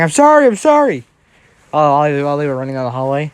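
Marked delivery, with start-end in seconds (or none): 0.0-1.0 high, loud frantic apology; shouting. Acted. Prankster fleeing Bakugo down the hallway, shouting. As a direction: yell it pitched way up, fleeing. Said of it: "Deku just shouting".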